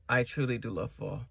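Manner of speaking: usual speaking voice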